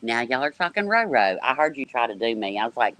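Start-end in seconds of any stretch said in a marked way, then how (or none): none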